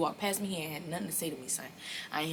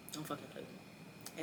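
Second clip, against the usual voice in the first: much softer repeat of a threat; quiet warning; 15 dB quieter than usual. Real, not acted. Storyteller warning anyone who would steal from her, quiet warning. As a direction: drop the volume right down to warn.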